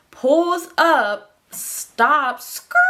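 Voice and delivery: exaggerated, drawn-out punches ending in a tire screech; hammed-up dramatization. Joking to viewers about the car pulling up, hammed-up dramatization. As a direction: play up the screeching stop for comic effect. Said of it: "I'm just kidding, he didn't do all that".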